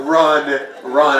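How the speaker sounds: loud mock urging to flee; mock urgent. Comic warning guys to run from commitment, loud mock urgency. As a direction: shout it like a fake alarm.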